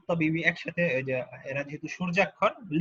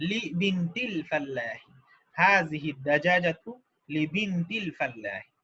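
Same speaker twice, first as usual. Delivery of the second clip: slow, careful model sentence; slow model reading. Shown as a demonstration. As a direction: read it slowly so the class can repeat. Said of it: "slow model sentence for class".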